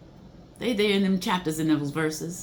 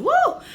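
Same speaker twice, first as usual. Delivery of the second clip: high whooping shout with a laugh; joyful woo; 6 dB louder than usual. Real, not acted. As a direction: let out a loud happy woo. Overcome while reading lyrics.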